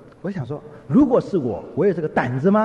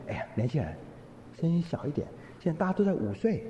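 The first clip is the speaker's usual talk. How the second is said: quiet scolding; 7 dB quieter than usual. Retold or imitated. Old man shushing the preacher.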